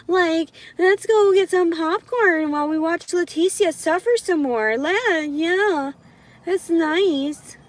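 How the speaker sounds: high, put-on mock eagerness; condescending voice. Speaker imitating onlookers enjoying their suffering, condescending. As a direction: chirp along in sarcastic agreement, pitched right up. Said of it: "that's the kind of condescending voice I do to men".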